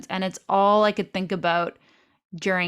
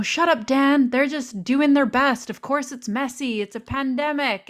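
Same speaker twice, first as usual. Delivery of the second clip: retold irritation, raised pitch; mock angry; 6 semitones higher than usual. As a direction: snap at the husband, hammed-up. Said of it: "I would get so angry at him".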